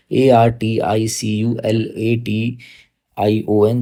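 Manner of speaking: each letter said apart, like a dictation; spelling out letters. Shown as a demonstration. Teacher spells the word for students, letter by letter. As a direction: spell articulation letter by letter, slowly. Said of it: "जिसका articulation".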